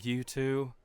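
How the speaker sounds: usual speaking voice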